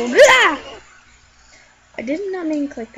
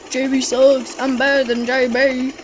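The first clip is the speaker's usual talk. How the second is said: ordinary talk, nothing marked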